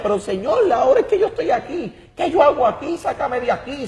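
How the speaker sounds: retold cry for help, pitched high and pleading; desperate plea. In hell, begging the Lord for rescue, high desperate plea. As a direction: beg to be let out, voice jumping high.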